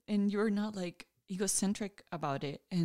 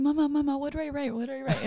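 imitating little daughter; 6 semitones higher, faster than usual. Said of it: "Adela kept saying".

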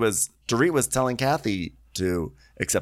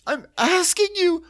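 very high, slow, louder mock insistence; mocking Kyle; 6 dB louder, slower than usual. Host imitating Kyle insisting to Kathy in a very high squeal. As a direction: squeal it out slowly. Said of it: "She goes".

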